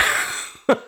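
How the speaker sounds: short unvoiced embarrassed giggle; verlegenes Lachen. Real, not acted. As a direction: laugh out of embarrassment. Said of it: "ich lache aus Verlegenheit". (verlegenes Kichern)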